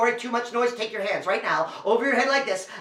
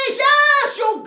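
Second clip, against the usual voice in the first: ordinary delivery, nothing marked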